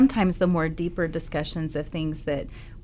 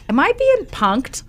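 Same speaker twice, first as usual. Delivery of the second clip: mock disbelief; 6 semitones higher, 6 dB louder than usual. Retelling her own disbelief at boy.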